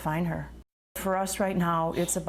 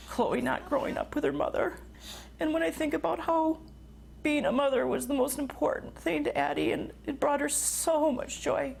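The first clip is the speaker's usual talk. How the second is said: higher, tearful voice of a grieving parent; choked up with grief; 7 semitones higher than usual. Real, not acted. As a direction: fight back real tears as you speak. Grieving mother recalls lost daughter.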